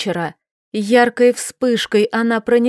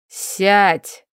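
barked command. A narrator's reading. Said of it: "рявкнул хозяин".

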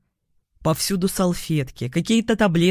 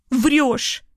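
high, hysterical outburst; angry accusation; 7 semitones higher than usual. A narrator's reading. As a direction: throw it out pitched up. Hysterical woman accuses him. (истеричный высокий выкрик)

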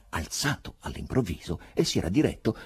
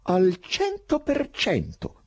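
emphatic; 13 semitones higher, 4 dB louder, much slower than usual. A narrator's reading. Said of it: "amava esprimersi in modo metaforico".

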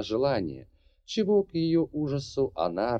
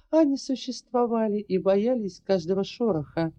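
put-on higher, lighter girl's voice; young woman voice; 12 semitones higher than usual. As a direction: voice the heroine, earnest and determined. Narrator voices Larri, high.

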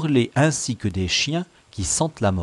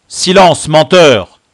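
loud, raised commanding rebuke; cutting him off; 6 semitones higher, 14 dB louder, slower than usual. A narrator's reading. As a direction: cut the liar short with authority. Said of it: "coupa le chef". (couper la parole avec colère)

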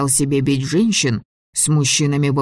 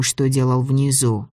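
ordinary delivery, nothing marked

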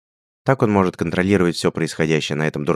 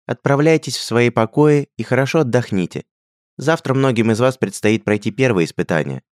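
prince's address; 6 semitones higher, 4 dB louder than usual. A narrator's reading. Prince announces to brides.

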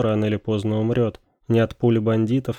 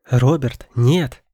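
shouting in protest; 3 semitones higher, 5 dB louder, much slower than usual. A narrator's reading. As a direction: yell it to stop him. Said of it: "закричала она".

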